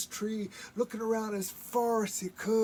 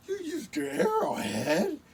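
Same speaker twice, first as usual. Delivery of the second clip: shocked; 8 semitones higher, 4 dB louder than usual. Acted. Coyote shocked.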